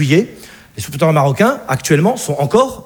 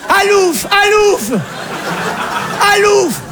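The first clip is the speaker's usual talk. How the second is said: Alsatian mother shooing; 11 semitones higher, slower than usual. Acted. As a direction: wave him off like a stray. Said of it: "elle met une tranche de jambon dessus". (la mère qui le chasse en criant, très aigu)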